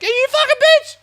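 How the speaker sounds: high-pitched loud screech; screaming TV drama. Acted. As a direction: screech it like a reality-show catfight. Acting out overheard TV drama.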